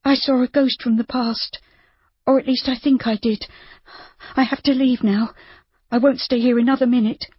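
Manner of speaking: put-on high, tense young woman's voice, rattled; a frightened girl who wants out now; Judy, badly shaken. A narrator's reading. Judy to Rob after seeing someone from her past, frightened and high.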